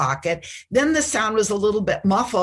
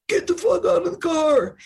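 mock cop yell; 9 semitones higher than usual. Retold cops yelling at son.

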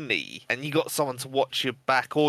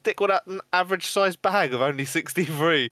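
mocking Andy; 7 semitones higher than usual. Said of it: "and you were like".

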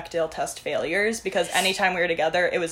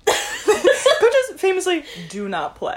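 laughing; 12 semitones higher, 5 dB louder, much slower than usual. Real, not acted. Laughing slow title line.

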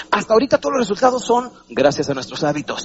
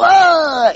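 sharp high loud gasp of shock; hammed-up startled cry; mock choking cry; 11 semitones higher, 6 dB louder than usual. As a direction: yelp as if hit by smoke. Body gasps at smoke.

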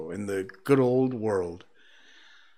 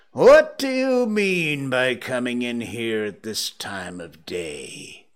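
feigned stern growl; 3 dB louder, slower than usual. Acted. Scrooge pretends to scold his late clerk, gruffly. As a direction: scold like a gruff boss, dropping away. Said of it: "growled Scrooge in its accustomed voice".

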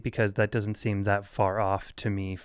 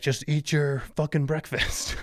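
higher-pitched frustrated parent outburst; 5 semitones higher than usual. Retold or imitated. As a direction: act out losing patience with a dawdling kid. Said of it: "I'll be getting frustrated".

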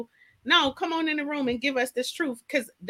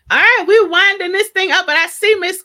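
loud, higher, calling out a welcome; excited welcome; 6 semitones higher, 10 dB louder than usual. Real, not acted. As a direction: hype up the guest as she arrives. Welcoming a guest on air.

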